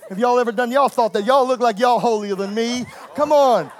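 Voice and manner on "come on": usual speaking voice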